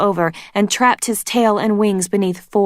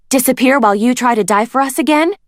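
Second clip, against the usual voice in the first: sarcastic; 6 semitones higher, 4 dB louder, faster than usual. A narrator's reading. Glory sarcastic retort.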